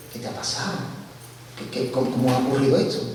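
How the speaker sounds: whispered, astonished question; stunned whisper. Retold or imitated. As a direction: breathe it out in disbelief. Uncle stunned by the news.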